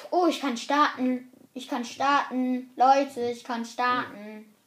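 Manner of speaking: loud, excited call to the others; eager shout to the lobby. Real, not acted. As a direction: announce the start loudly and gladly. Excited game start announcement.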